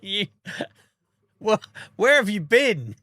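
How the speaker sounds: incredulous. Real, not acted. To a viewer who thought he sounded French, amazed outburst. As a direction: blurt it out, amazed. Reacting to viewer's Scottish guess.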